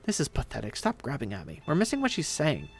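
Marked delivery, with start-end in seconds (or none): 0.0-1.6 low, soft, annoyed protest; stage whisper. Acted. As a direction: complain in a hushed voice mid-tussle. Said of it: "his loud, shitty whispering".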